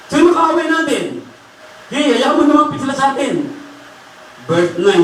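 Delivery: high, defiant sermon voice; impassioned preaching. Acted. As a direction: challenge any enemy before the congregation. (mapanghamong pangangaral na mataas ang boses)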